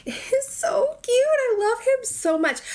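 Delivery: high, slow, teary gushing. Real, not acted. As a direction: overcome by how cute it is. Gushing over lit figurine.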